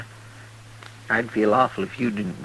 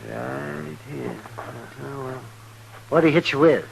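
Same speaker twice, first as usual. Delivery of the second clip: ordinary talk, nothing marked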